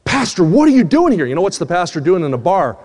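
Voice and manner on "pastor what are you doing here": startled, higher-pitched question; shocked. Retold or imitated. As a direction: react in shock to an unexpected face. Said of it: "I looked up shocked".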